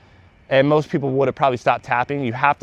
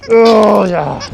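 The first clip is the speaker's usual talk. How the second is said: excited; 7 semitones higher, 8 dB louder than usual. Real, not acted. Praising dog.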